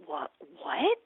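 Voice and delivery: high, stammered bafflement; retold astonished sputtering; acted disbelief. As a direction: play it utterly taken aback. Acts baffled reply to patient.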